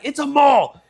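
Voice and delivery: high, loud frustrated correction; imitated exasperated yell. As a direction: yell it like a kid fed up with teasing. Acted quarrel with trolling dad.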